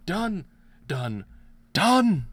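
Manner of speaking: loud, high victory yells; triumphant shout. Real, not acted. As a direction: shout it out like you just won. Triumphant cries after winning fight.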